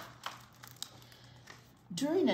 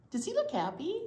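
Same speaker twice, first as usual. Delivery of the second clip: high bright question; excited question; 14 semitones higher, 3 dB louder, faster than usual. Real, not acted. Teacher asking the kids about John's face, high bright question. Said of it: "question to children".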